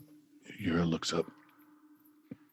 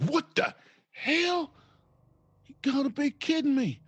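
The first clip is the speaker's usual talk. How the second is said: startled disbelief. Acted. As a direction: recoil in high-voiced, acted shock. Startled character backs away from creature.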